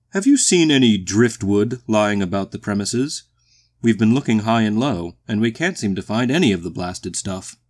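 loud, blustery courteous boom; booming, genial walrus. A narrator's reading. The walrus asks strangers about driftwood, blustery boom. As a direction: boom out a polite enquiry.

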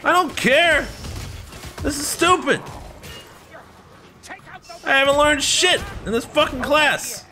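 high-pitched, loud yelling tirade; furious rant. Acted. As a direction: let loose an outraged, screamed rant. Acted imagined rant back at teacher.

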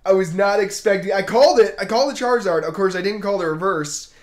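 elated shouting; excited. Real, not acted. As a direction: still reeling from the pull, loud and high. Still elated after the pull.

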